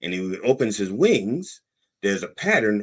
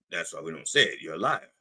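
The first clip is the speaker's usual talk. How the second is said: ordinary talk, nothing marked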